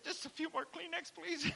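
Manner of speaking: imitating his teary self asking the flight attendant for tissues; mock tearful plea. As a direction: ham up a weepy request for tissues. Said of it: "I cried most of the way home".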